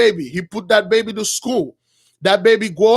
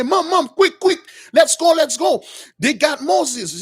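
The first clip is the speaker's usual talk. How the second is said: urgent excited call; 6 semitones higher than usual. Acted. Girl urgently calling her mother.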